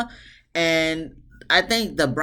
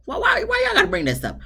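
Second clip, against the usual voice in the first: much higher, pleading why-why-why; exasperated protest; faster than usual. Retold or imitated. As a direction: throw up your hands, why bring this up. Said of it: "I was just like".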